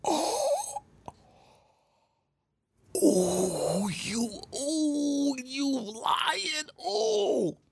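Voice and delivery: high drawn-out gasps, near crying; mock stunned wail; shocked disbelief. Man in the corner, high shocked wailing. Watching her lie to police.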